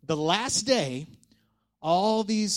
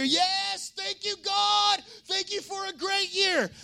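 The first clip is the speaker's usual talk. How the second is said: high, drawn-out cheer of thanks; cheering crowd; 12 semitones higher, slower than usual. Acted. Crowd thanking God at the ceremony, high cheering. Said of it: "the people would cheer".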